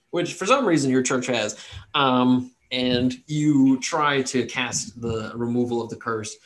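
usual speaking voice

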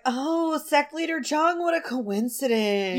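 high, put-on innocent surprise; mock surprise. Podcaster voicing a character greeting a sect leader, mock surprised.

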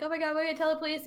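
acted fluster, rushed; mock freaking out. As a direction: rattle it off in imitated panic. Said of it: "like, freaking out".

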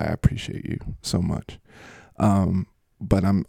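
normal talking voice

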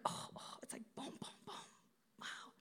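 soft, breathy whisper of wonder; hushed amazement; awed whisper. Pretend. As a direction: whisper it as it dawns. Whispering the moment of realization.

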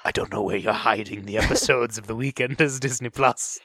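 low-pitched parody of a movie threat; mock menacing threat. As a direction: deliver a hammed-up vow of revenge.

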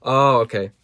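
plain reading voice

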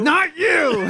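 full mock shout, high and loud; imitated boss bark. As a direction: yell it like an angry boss. Echoing the boss's yell.

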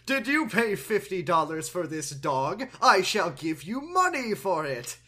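loud theatrical king; hammy stage actor. Retold or imitated. Voicing King Ham.

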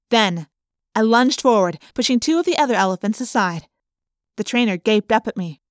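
plain reading voice

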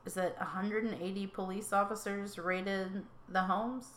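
ordinary talk, nothing marked

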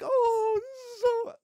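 high, choked-up gush; mock tearful. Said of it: "I'm like".

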